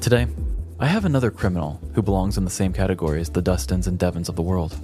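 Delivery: usual speaking voice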